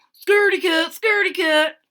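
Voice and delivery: high, loud chirpy taunt; chirping birds. A narrator's reading. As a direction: chirp it from the treetops. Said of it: "they chirp at Sarah".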